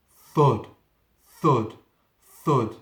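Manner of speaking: loud, heavy thud on each word; booming thuds. Acted. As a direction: pound each thud out like a fist on wood. Door pounded by monster.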